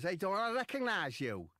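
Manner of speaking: high, loud mock Scouse lass; lightly voiced Scouse woman; a bit scouse. Lass recognising the footballer in a mock Scouse accent. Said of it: "That's a bit scouse".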